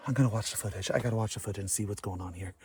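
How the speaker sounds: normal talking voice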